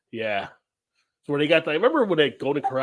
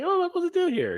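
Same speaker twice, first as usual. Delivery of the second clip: high silly voice. Retold or imitated. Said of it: "it's like".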